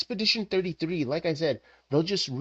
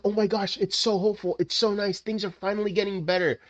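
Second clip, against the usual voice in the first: high, chirpy and full of hope; naive hopeful player; 6 semitones higher than usual. Retold or imitated. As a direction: play the gullible happy gamer. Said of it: "game's like".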